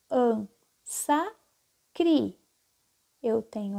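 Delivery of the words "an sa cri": "An, sa, cri" said syllable by syllable with pauses; split into syllables. Shown as a demonstration. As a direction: separate "An, sa, cri" slowly for children. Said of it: "as sílabas da palavra". ("An, sa, cri" separado em sílabas, pausado)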